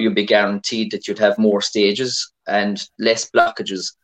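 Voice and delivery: ordinary talk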